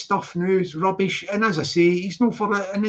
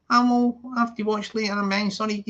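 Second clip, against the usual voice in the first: ordinary talk, nothing marked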